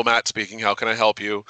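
ordinary delivery

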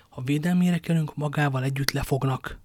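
ordinary delivery

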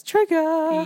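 high, slow mock indignation; mock offended. Real, not acted. To her friend after the movie, drawn-out mock indignation. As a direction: drag it out, pretending to be wounded.

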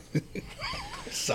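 high steady siren wail. Pretend. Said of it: "That's my best impression of a police car".

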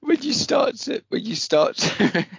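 high, slow, breaking with laughter; forcing words through giggles; laughing while talking. Real, not acted. Laughing, can't get words out.

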